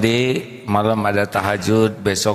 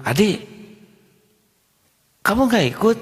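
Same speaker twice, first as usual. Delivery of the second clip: calling out; 11 semitones higher, faster than usual. Retold or imitated. Teacher calls from the bus.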